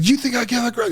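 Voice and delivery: high-pitched mock outrage; retold angry outburst. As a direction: imitate someone going crazy, pitch jumping up. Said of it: "just starts going crazy".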